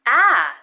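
ah said short and flat, a clear open æ; short a sound. Shown as a demonstration. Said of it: "The short A".

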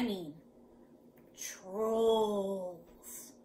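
slow, drawn-out spooky "trolls"; ominous. Shown as a demonstration. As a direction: stretch the word out with menace. Sets real trolls against cute ones.

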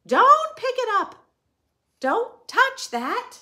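high, loud urgent cry; alarmed warning. Acted. The seller warning the customer off the creature, alarmed and loud. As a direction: stop them from grabbing it.

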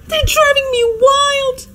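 high, frantic wail of despair; exasperated wail. Acted. Hostess despairing over her dinner guests, exasperated wail. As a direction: wail it as if at your wits' end.

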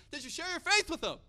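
high, loud retold question; eager question. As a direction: press her eagerly, acting it out.

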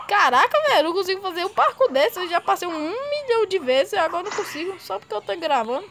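high, loud, fast complaining; exasperated rant. Real, not acted. Ranting after failed parkour.

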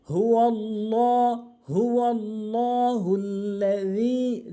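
"হুয়াল্লাহ" chanted slowly with a heavy, rounded "lo"; correct heavy L. Shown as a demonstration. Teacher corrects "la" to "lo", slow chanted "হুয়াল্লাহ" with heavy L. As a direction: stretch "হুয়াল্লাহ" on a full-mouthed L, not "la". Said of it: "লা বলে ল বলতে হবে".

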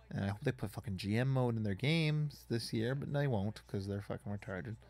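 ordinary talk, nothing marked

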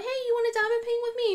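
put-on high singsong invite to a child; mother inviting her young son, high singsong; sweet mom voice. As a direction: coax him sweetly up high. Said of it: "I'm like".

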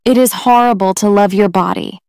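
usual speaking voice

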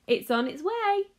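high, loud thrilled cry; excited cry. A narrator's reading. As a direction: burst out with glee. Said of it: "cried Mister Wonka".

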